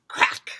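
loud, abrupt cracking word; sharp crack. A narrator's reading. Bed falls into the hole, loud crack. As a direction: hit it like breaking wood. Story sound effect read aloud.

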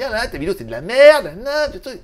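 hammed-up heckler rant; mocking angry commenters. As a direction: imitate outraged viewers, jumping up high. Mimics angry viewers' comments.

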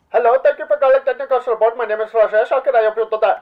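hammed-up Indian call-centre accent, fast; mock Indian accent. Mocking a support agent from India, fast Indian accent. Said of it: "somebody from, like, a third world country like India".

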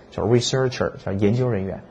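ordinary delivery

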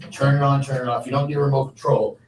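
ordinary talk

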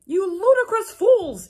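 high, loud, slow mock headmaster; hammed-up shout. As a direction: boom it slowly like an angry old wizard. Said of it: "he shouted".

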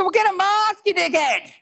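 high-pitched exasperated mock outburst; imagined angry retort. Husband's unsaid reply to wife about the light, mock fury. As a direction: let rip in a very high voice, for comedy.

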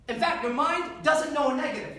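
usual speaking voice

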